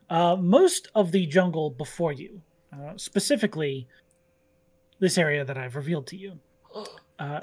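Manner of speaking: lightly voiced scene-setting narration; game master narration. A narrator's reading. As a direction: paint the jungle calmly for players.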